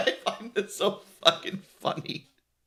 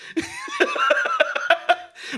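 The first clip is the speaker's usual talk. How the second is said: very high squeaky laugh; wheezy high giggle; laughter. Real, not acted. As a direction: crack up in a squeal. Sound of the speaker's own laughter.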